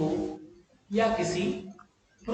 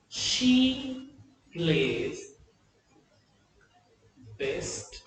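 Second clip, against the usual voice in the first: slow dictation; 6 semitones lower, 5 dB quieter, much slower than usual. Shown as a demonstration. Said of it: "slow dictated example".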